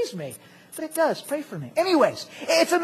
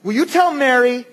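raised, louder voice of mock exasperation; indignant rebuke; 5 semitones higher, 7 dB louder than usual. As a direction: confront him as a fed-up sister, acted out. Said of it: "confronts and rebukes Jesus".

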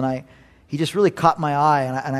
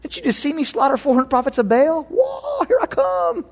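cocky prophet, much higher, rising to a shout; bold Elijah; 10 semitones higher than usual. Retold or imitated. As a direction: brag like a triumphant prophet. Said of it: "We think he'd say".

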